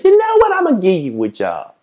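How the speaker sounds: high, loud and cheery, like a boss handing over a job; a hammed-up boss finally saying yes; imitated boss. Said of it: "smile and say".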